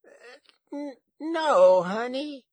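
high, hesitant granny, swelling loud; stammer, then draw out a high answer; high-voiced dazed grandmother; considering grandma. A narrator's reading. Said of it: "she considered".

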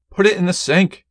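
high, loud panicked shout; urgent shout. Acted. Husband yells to wife at burning pan, urgent shout. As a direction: yell it over the fire alarm. Kitchen fire, shouting instruction.

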